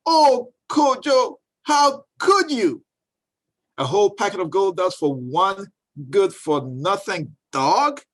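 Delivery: drawn-out cry of a shocked mother; dismayed mother. Acted. Mother dismayed at wasted gold.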